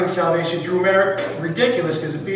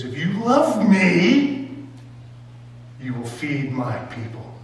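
tender Jesus; much slower than usual. Acted. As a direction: draw it out slowly and gently. Jesus speaking to Peter.

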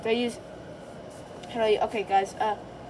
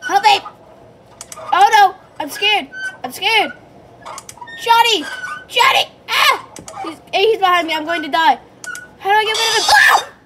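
high, loud panicked screaming; panicking gamer; 9 semitones higher, 10 dB louder than usual. Real, not acted. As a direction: scream for help in real panic. Chased by animatronic, screaming.

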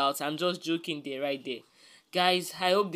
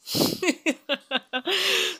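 high laugh; 9 semitones higher than usual. Real, not acted. Host laughs at her own joke.

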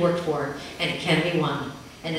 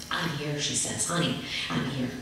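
much softer, lower reassurance; comforting mother; 3 semitones lower, 6 dB quieter than usual. A narrator's reading. Mother comforting sobbing child on floor, soft low murmur. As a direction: murmur it close and tender. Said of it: "mother comforting sobbing child".

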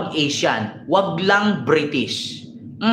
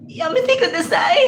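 much higher parody pleading; mock Japanese pleading; 11 semitones higher than usual. Imitating Japanese women, high mock pleading. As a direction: whimper it high as a joke. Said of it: "meron pang sasabihin na".

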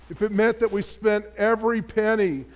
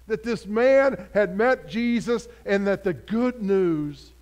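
ordinary talk

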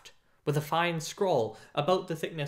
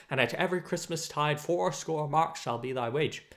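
ordinary delivery, nothing marked

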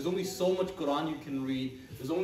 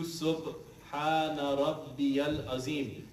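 slow drawn-out recitation; slower than usual. Shown as a demonstration. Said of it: "You're gonna read it a hundred times".

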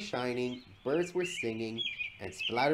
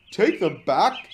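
loud, raised, disbelieving question; raised-voice incredulous question; indignant echo; 7 semitones higher, 10 dB louder, slower than usual. A narrator's reading. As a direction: throw the order back in disbelief. Boko echoes the order, indignant.

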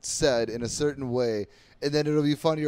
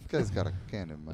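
mock Gruden; 9 semitones lower, 8 dB quieter than usual. Mocking Gruden impression.

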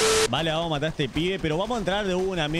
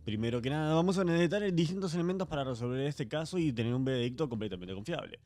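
hushed, serious investigator voice; softer mock-serious investigator announcement; mock detective host; 3 semitones lower, 6 dB quieter than usual. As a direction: play a solemn forensic expert, softly.

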